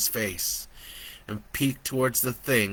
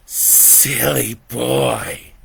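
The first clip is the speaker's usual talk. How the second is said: mocking devil; 5 dB louder, much slower than usual. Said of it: "it laughed".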